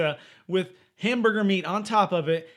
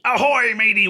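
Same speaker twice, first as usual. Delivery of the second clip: pirate voice; 5 semitones higher, 9 dB louder than usual. Pretend. Pirate day greeting.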